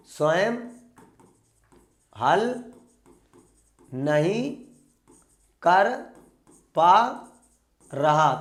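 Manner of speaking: long pause after each of स्वयं, हल, नहीं, कर, पा, रहा; word by word. Shown as a demonstration. Teacher drilling the exam phrase into students, word by word with long pauses. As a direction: dictate it one isolated word at a time: स्वयं... हल... नहीं... कर... पा... रहा.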